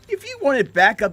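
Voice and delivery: laughing tease. Acted. Laughing while teasing a friend.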